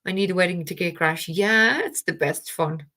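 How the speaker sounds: ordinary delivery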